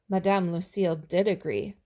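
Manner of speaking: ordinary delivery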